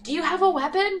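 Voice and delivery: high-pitched, mock panicked demand; retold alarmed question, pitched high; retold freaking out. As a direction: imitate someone freaking out, pitch jumping up. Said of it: "Like, freaking out".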